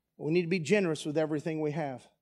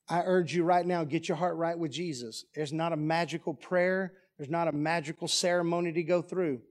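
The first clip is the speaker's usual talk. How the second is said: usual speaking voice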